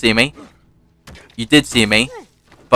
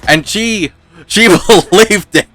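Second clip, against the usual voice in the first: high-pitched laugh breaking through the words; 9 semitones higher, 3 dB louder than usual. Real, not acted. Telling a friend the lie worked, laughing. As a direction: let the laugh crack the line up high.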